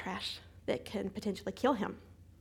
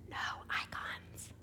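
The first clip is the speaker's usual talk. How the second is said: breathless hushed whisper; stunned whisper; whispered disbelief; 10 dB quieter, much slower than usual. Retold or imitated. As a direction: whisper it like you can't believe your eyes. Stunned discovery of empty screen.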